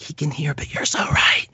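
hushed whisper of a coworker. Retold or imitated. Nutritionist whispering so the doctor won't hear her. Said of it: "She's like".

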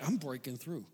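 barely audible, mostly breath; breaking through, hushed. Retold or imitated. Pastor on ride, murmuring to himself very softly. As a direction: say it under your breath to yourself. Quietly pushing through roller coaster fear.

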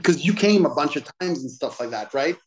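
ordinary talk, nothing marked

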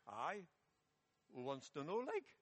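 put-on schoolboy reply in a mixed Welsh-Geordie accent. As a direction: challenge back in Welsh-tinged Geordie, lifting sharply at the end. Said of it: "a Welsh Geordie accent".

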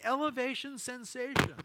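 high, loud slam-dunk call; basketball announcer. Retold or imitated. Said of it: "a basketball announcer for the Warriors".

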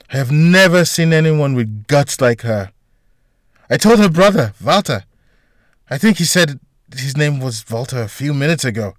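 a hint of an excitable hospital gossip; lightly voiced gossipy janitor, admiring.

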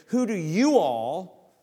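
slow, loud Southern drawl on "you all", stretched out. Pretend. Preacher playing a Southern Jesus, asking in a slow Southern drawl. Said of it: "as a good Southern Jesus would do".

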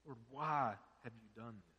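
soft, hushed plea to the Lord; hushed lament. Retold or imitated. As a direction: murmur the complaint quietly. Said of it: "and say".

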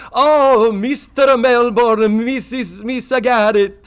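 high, drawn-out Italian maitre d' voice; Italian accent. Retold or imitated. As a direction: greet them like an Italian host, slow and loud. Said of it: "I'm doing Italian".

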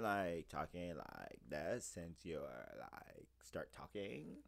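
put-on low, soft, slow Valley girl drawl; fake Valley voice. Shown as a demonstration. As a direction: imitate Valley talk, dragging words and sinking low. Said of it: "it's a fake voice".

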